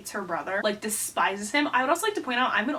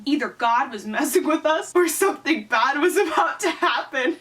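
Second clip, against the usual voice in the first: higher, building melodrama; melodramatic story reading; 4 semitones higher, 4 dB louder than usual. Acted. Reading her childhood cliffhanger aloud, mounting melodrama.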